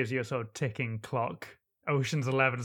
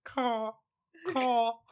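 crow caw; 9 semitones higher than usual. Acted. Player imitates crow.

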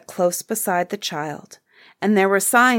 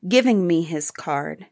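usual speaking voice

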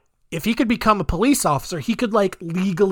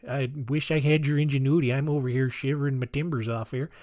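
soft, lightly put-on Canadian voice with an "eh"; a friendly, lightly hammed Canadian neighbour teasing; playful Canadian accent; 3 semitones lower, 6 dB quieter than usual. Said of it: "I don't know what Canadian voice that is".

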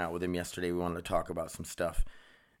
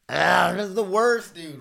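high, loud exasperated cry; frustrated outburst; 11 semitones higher, 8 dB louder than usual. Real, not acted. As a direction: let the frustration burst out. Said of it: "I'm all over the place".

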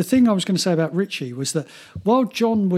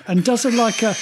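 ordinary delivery

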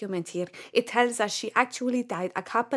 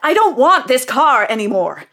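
angry outburst; 3 semitones higher, 7 dB louder than usual. Acted. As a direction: explode at the agent, totally fed up. Customer snaps at agent.

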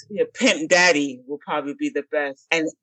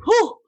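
relieved whew; 3 dB louder than usual. Retold or imitated. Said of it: "I was so nervous".

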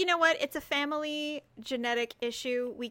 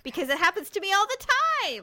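high, loud outburst; real high-pitched confession; exasperated admission; 9 semitones higher, 5 dB louder than usual. Real, not acted. Sister exclaims shared trouble.

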